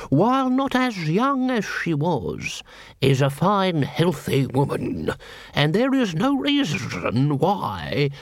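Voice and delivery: high, slow, precise lawyer reading out terms; a fussy lawyer spelling out the deed; prim lawyer voice. A narrator's reading. Lawyer to Vimes, fussy and slow.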